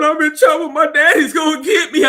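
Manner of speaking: high, loud, half-laughing imitation of a scared boy's cry; imitating a wailing kid. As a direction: mimic the kid bawling that his daddy will get him, high and loud. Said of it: "I mean, he's wailing".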